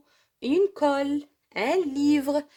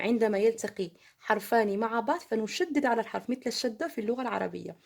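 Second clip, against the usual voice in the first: ordinary talk, nothing marked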